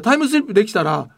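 ordinary delivery

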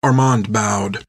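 plain reading voice

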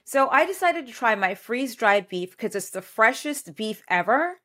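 upbeat sponsor read voice. Acted. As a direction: sell it like a radio spot. Host reads sponsor ad.

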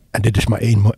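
talking with a cold, blocked nose; stuffed-up nose from a cold; stuffy nose. Real, not acted. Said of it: "sorry, ik ben verkouden".

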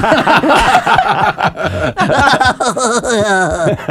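high squeaky cartoon laugh; SpongeBob laugh. Shown as a demonstration. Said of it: "SpongeBob again".

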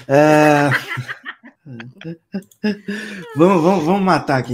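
loud laughing push forward. Real, not acted. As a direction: laugh through the words. Laughing while moving on.

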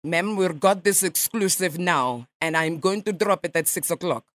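a light mock Russian accent, nasal ma'am; radio prank caller in a lightly faked Russian accent; put-on Russian accent. Prankster calls a stranger as a Russian journalist, mock Russian accent. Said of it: "for a Russian, your English is quite good".

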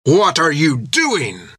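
slow, high angry shout; the captain rages at the crew; livid. A narrator's reading. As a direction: demand an answer, furious. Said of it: "livid with anger".

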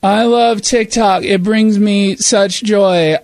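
slow, joyless mock delight with fake laughs; deadpan mockery. Host mocking a grandma's TikTok plea, slow deadpan mockery. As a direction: mimic forced joy, dragging the words out.

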